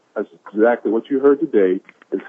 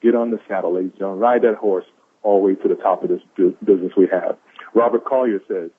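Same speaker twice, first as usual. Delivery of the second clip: ordinary delivery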